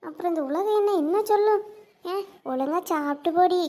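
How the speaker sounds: ordinary talk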